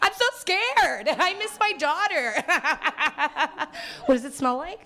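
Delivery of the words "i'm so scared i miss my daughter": high, slow, hammed-up fright; squealing mock panic; mock terror. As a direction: play the scared blindfolded housewife to the hilt. Housewife scared in blindfold.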